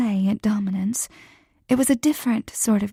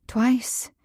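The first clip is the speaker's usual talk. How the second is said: ordinary delivery, nothing marked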